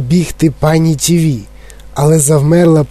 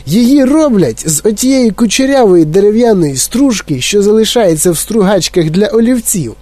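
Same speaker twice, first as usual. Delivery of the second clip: higher, disgusted eccentric chocolatier; eccentric Wonka; 6 semitones higher than usual. Acted. Wonka scolding Mike about oatmeal, disgusted. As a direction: lecture the boy with distaste.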